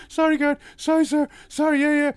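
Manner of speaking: high, loud frantic sorry; flustered submissive sorries; panicked apology. Retold or imitated. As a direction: grovel fast to the angry guard. Apologising to shouting guard.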